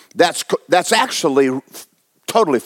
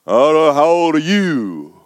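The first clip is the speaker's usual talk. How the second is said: mimicked old man, lower than usual; older man; 4 semitones lower than usual. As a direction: answer like an old man turning the question back. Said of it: "when I'm talking to older men".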